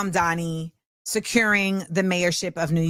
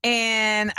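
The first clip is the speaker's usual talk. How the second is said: usual speaking voice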